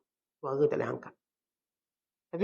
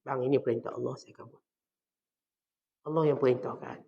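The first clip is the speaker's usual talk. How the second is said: gentle but firm wife; 2 semitones lower, 5 dB quieter, faster than usual. Acted. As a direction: reason quietly with husband, without raising the voice. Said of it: "Dengan bahasa seorang isteri".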